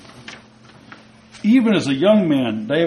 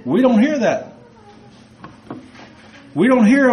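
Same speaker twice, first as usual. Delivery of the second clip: preaching emphasis; 7 semitones higher, 3 dB louder than usual. Real, not acted. Preacher driving point home.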